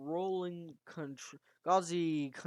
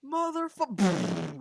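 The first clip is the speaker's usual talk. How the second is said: higher, louder, drawn-out mock yell; scream of pain; 5 semitones higher, 7 dB louder, much slower than usual. A narrator's reading. Narrator voicing Collector hit by an attack, mock pained scream. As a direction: act out a cut-off cry of pain. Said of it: "Collector screams in pain".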